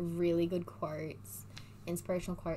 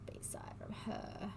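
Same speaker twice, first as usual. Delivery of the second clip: whispering; 12 dB quieter, slower than usual. Real, not acted. Whispered reading of question.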